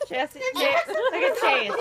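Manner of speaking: laughing. Real, not acted. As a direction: laugh while calling his name. Laughing at Chase.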